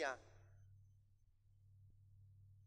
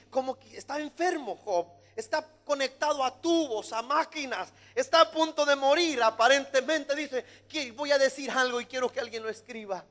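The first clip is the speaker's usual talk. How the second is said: high, incredulous-sounding sermon delivery; animated preaching; 7 semitones higher, 6 dB louder than usual. Acted. Preacher questioning dying Job, animated preaching. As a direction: press the question with raised pitch. Preacher dramatizes Job's sickbed.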